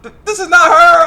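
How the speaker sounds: loud, very high shriek; comic rising wail; mock horrified scream. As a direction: yell in panicked shock, acted out. Acted shock at wrong person.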